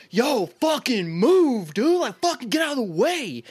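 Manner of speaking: high, drawn-out mock yell; retold yell. Angry passerby squeezing past on the sidewalk, retold high yell. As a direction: imitate an irate stranger yelling to clear the way. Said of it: "I mean, yelled".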